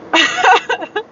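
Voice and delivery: high-pitched burst of laughter; amused laugh. Real, not acted. Vlogger joking about ghosting people with her paleness, amused laugh. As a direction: laugh at your own joke.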